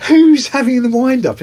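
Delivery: retelling his disbelief at the prank call; incredulous. Retold or imitated. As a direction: squeak it out like it's a wind-up. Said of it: "So I'm going".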